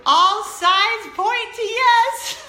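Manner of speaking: high, loud, slow, laughing through the words; laughing while reading. Retold or imitated. Older daughter reading the ball aloud, mother laughing hard and high. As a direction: read it out cracking up. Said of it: "and it says".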